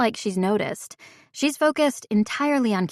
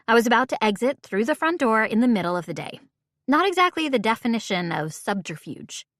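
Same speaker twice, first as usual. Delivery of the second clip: plain reading voice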